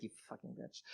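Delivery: whispered insult under the breath; muttered aside. Retold or imitated. Storyteller privately cursing the richer lawyer, under his breath. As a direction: hiss it privately, almost inaudibly. Private jab after polite reply.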